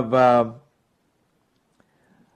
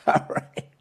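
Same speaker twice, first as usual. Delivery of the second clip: usual speaking voice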